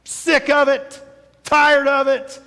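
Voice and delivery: mock an exasperated churchgoer done with covid; fed-up complaint. Pastor voicing frustrated covid-weary listener, fed up. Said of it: "maybe you're on the side that goes".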